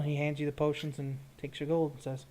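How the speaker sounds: ordinary talk, nothing marked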